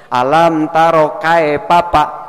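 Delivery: "kae papa" with a Sundanese E and P sound, slow; mispronouncing "Alam taro kae papa" the Sundanese way, slowly; Sundanese-style Arabic. Shown as a demonstration. Ustaz showing the congregation the wrong reading, slowly. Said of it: "Ini bahasa Sunda".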